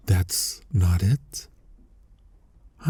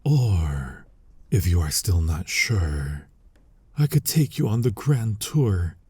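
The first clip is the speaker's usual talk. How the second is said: ordinary talk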